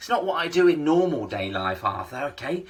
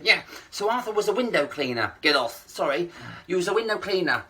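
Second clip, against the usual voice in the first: ordinary talk, nothing marked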